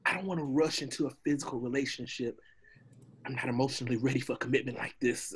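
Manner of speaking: soft, fast excuses; nervous stalling. Acted. Donkey to the amorous dragon, nervously making excuses. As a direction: talk your way out quietly and quickly.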